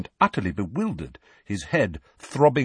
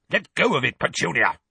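urgent raised voice, starting high; roaring uncle; 7 semitones higher, 3 dB louder than usual. Acted. Uncle warning his wife off the smoking letter, roaring. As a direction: order her off it with alarm. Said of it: "roared Uncle Vernon".

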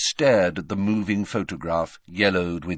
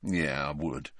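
low, soft grudging admission; reluctant, lowered agreement; 5 semitones lower, 7 dB quieter than usual. A narrator's reading. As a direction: concede it reluctantly under your voice. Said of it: "said Ron grudgingly".

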